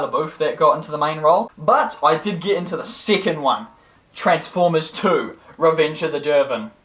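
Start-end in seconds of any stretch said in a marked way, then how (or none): none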